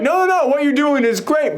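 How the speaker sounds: lightly hammed-up reassurance to his sister; playful mock cheer; voicing Pietro. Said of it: "be like".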